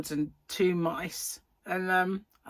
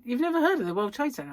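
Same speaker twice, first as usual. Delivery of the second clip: retold disbelief; 7 semitones higher, 4 dB louder, much faster than usual. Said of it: "And he went".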